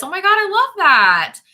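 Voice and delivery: high, loud burst of joy; delighted. Real, not acted. Host reacting to a viewer's comment, excitedly squealing. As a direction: gush over the good news.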